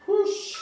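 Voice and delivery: loud forceful whoosh of breath; short, loud puff of effort; sharp exhale. Real, not acted. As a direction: blow out hard from the exercise. Blowing out effort during workout.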